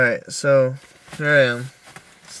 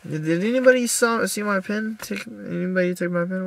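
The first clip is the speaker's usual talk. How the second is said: asking around; 7 semitones higher than usual. Retold or imitated. Asking around for stolen pin.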